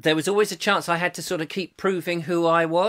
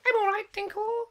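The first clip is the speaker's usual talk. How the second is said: mock Julia reply; 5 dB quieter than usual.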